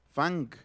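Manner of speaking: fang with a hard g at the end. Shown as a demonstration. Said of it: "No vamos a decir". (fang con g final marcada)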